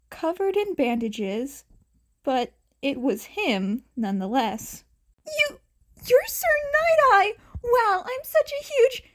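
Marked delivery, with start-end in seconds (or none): 5.3-9.0 very high, loud gushing; starstruck fan. Acted. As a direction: choke out fan excitement. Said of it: "Izuku choked out".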